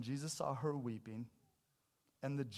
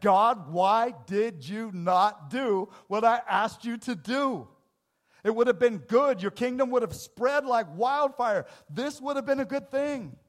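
loud frustrated prayer; 8 semitones higher, 15 dB louder than usual. Retold or imitated. Churchgoer crying out to God in frustration, loud. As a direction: shout your hurt at God. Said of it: "How many times have you said".

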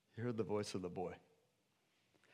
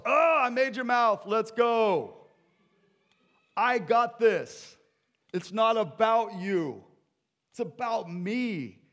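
high, shouted divine outburst; exasperated God; 10 semitones higher, 11 dB louder, slower than usual. Acted. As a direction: shout down the excuses with full authority.

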